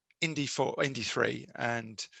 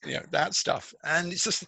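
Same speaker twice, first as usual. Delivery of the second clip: usual speaking voice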